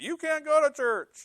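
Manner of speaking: very high, drawn-out mocking voice; high-pitched, slow parody of an order; mock prohibition. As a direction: stretch it out high like a silly scold. Mocking covid-era church ban.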